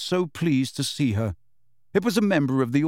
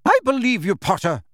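brisk, high and louder; curt; 10 semitones higher, 5 dB louder than usual. A narrator's reading. McGonagall answering Harry, curt. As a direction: clip it short and decisive. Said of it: "said Professor McGonagall curtly".

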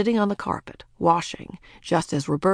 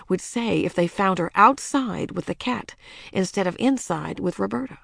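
ordinary delivery, nothing marked

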